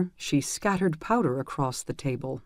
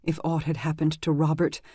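usual speaking voice

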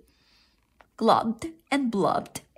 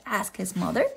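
usual speaking voice

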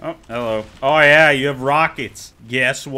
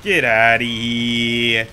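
slow, lower, hammed-up taunt in a put-on voice; over-exaggerated taunt; 5 semitones lower, much slower than usual. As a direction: drag it out like a cartoon tough guy. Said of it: "I always have to over-exaggerate the first one".

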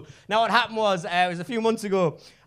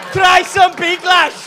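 pushy shout; 9 semitones higher, 12 dB louder, much slower than usual. Retold or imitated. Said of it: "scoops some up and goes".

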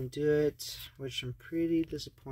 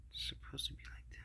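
whispering; 13 dB quieter, faster than usual. Real, not acted. Muttering at wrong color.

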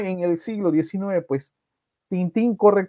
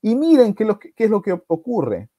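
ordinary talk, nothing marked

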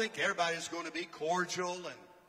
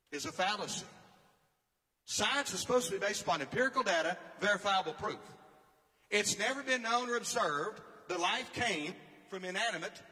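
ordinary delivery

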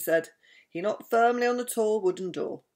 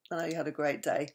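plain reading voice

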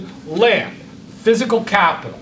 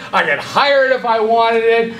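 normal talking voice

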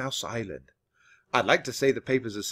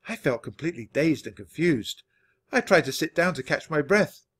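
plain reading voice

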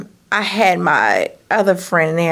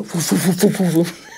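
mock grunts of effort; 3 semitones lower than usual. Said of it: "and you know I said".

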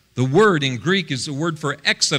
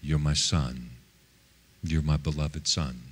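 very low, soft, tender affirmation; the Father's voice; 11 semitones lower, 8 dB quieter than usual. Retold or imitated. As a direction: voice the Father quietly and deep. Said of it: "hear the Father say".

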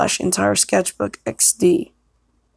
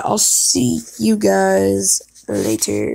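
drawn-out goodbye; much slower than usual. Acted. Slow drawn-out sign-off.

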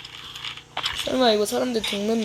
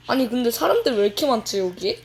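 usual speaking voice

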